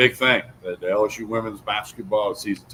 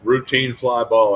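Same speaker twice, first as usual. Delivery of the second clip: Routine drawn out slowly and louder; stress on Routine; 2 semitones higher, 6 dB louder, slower than usual. Real, not acted. Host recounting the missed catch, Routine slowly emphasized. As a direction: lean hard on Routine, stretching it out. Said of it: "I emphasize the word routine".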